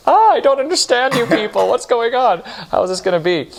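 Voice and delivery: high, strained mock bewilderment; new arrival baffled by the conductor, mock exasperated; bewildered exasperation. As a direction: play your younger self totally lost. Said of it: "And I was just like".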